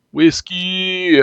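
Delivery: overjoyed call to the cat; joyful cry. Acted. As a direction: cry out her name like a long-lost friend.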